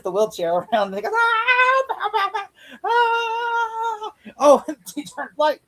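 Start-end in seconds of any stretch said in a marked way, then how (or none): 1.1-4.1 very high, hammed-up yelping cries; mock yelps. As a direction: yelp it in short bursts. Imitating Bane's reaction to wheelchair.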